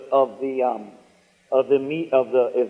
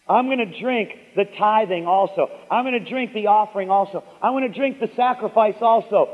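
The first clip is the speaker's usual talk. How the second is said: high, emphatic preacher's exhortation; full-force preaching; 6 semitones higher, 4 dB louder than usual. Real, not acted. Preacher urging congregation to drink all of it, forceful and rousing. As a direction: drive each repeated command home, pitched up.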